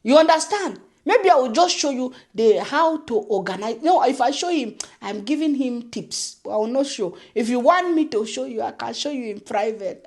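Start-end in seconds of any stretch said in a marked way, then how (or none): none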